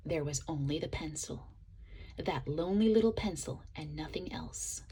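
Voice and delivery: sad hushed whisper. A narrator's reading. Lonely pencil left alone.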